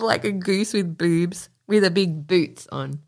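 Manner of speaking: usual speaking voice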